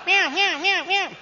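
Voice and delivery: high mewing meows; cat meows. Retold or imitated. Preacher imitating cat crying during the drive, high loud mewing. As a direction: mew like a cat crying from the engine. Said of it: "hear the cat the whole way going".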